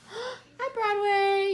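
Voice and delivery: high squeal to a dog; excited greeting. Real, not acted. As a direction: squeal hello at the puppy.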